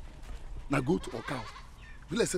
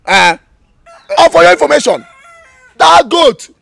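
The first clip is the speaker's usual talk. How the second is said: much higher and loud, blurting; panicked shout; 10 semitones higher, 14 dB louder than usual. Acted. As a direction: yell defensively at the woman. Boy shouts at the mother.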